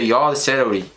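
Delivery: usual speaking voice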